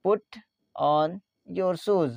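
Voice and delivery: "Put", "on", "your shoes", spaced out; word by word. Shown as a demonstration. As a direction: dictate "Put on your shoes" with pauses between words. Teacher repeats phrase word by word. ("Put on your shoes" शब्दों को अलग करके)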